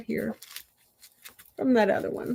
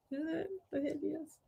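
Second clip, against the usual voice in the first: much softer, muttered second ask; quiet repeat; 6 semitones higher, 14 dB quieter than usual. Real, not acted. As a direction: say it again under your breath. Repeats question to herself.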